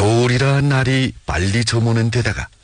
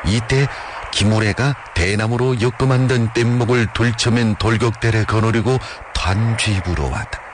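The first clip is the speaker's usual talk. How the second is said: usual speaking voice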